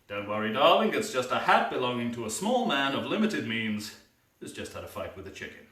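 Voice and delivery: usual speaking voice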